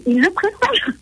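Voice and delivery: high, laughing delivery. Real, not acted. Speaker amused at praise of the French accent, laughing. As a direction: let the words tip over into a giggle.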